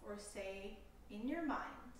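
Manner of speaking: slow, calm meditation voice with long pauses; guided meditation. Acted. Yoga teacher leading listeners through a compassion meditation, calm guided delivery. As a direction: guide them unhurriedly into stillness, leaving space between phrases.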